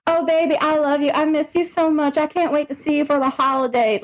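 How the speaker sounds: high, sugary fake affection; mock sweet talk. Livestream sweet-talk to donors, high and sugary. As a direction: coo it at paying fans.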